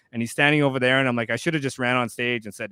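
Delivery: ordinary talk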